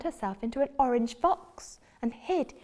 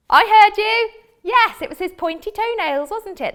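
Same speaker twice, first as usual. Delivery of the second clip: excited; 11 semitones higher, 11 dB louder than usual. Real, not acted. Teacher praises children.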